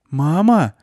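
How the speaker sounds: high, loud disbelieving cry. A narrator's reading. Klyusya sees her dead mother, disbelieving. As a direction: she can't believe it's her mother. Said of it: "неверяще спросила Клюся".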